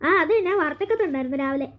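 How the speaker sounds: ordinary talk, nothing marked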